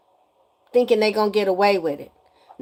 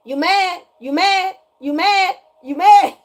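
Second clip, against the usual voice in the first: high, repeated jab, louder on the last; taunting; 7 semitones higher, 4 dB louder than usual. Real, not acted. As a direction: taunt them in a high pitch. Taunting her detractors.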